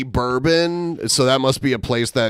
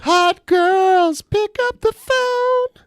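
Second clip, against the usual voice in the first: very high, very slow mock hotline voice; phone sex commercial; much slower than usual. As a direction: stretch it out sky-high like a cheesy hotline ad. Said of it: "that phone sex commercial".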